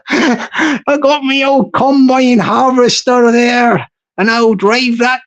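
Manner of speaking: slow, hammed-up West Country farmer; mock West Country accent. Joking about driving his combine harvester, slow mock West Country voice. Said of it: "It sounds like the southwest of England".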